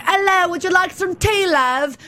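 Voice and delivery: put-on polite British accent. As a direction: play the courteous Brit in a British accent. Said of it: "always raised to be so polite".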